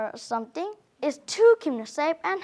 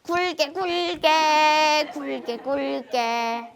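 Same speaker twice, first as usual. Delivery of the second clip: laughing; 6 dB louder than usual. Acted. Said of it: "little frog just laughed".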